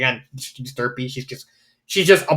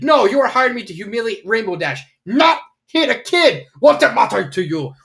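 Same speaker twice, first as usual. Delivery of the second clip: angry scolding; 6 semitones higher than usual. Retold or imitated. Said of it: "gets pissed".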